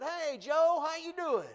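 high, loud hello across the room; friendly greeting. Retold or imitated. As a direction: call out cheerfully to an old friend. Guy greets Joe at gym.

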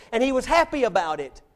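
ordinary talk, nothing marked

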